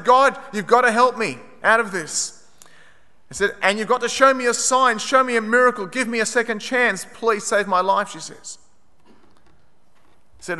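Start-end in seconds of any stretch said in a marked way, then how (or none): 0.0-2.4 higher, louder retold plea to God; desperate prayer. Trapped woman prays to God under the rubble, pleading. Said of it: "you pray". 3.6-8.1 raised-pitch retold pleading; desperate prayer. Trapped woman begs God for a second chance, pleading. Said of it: "you pray".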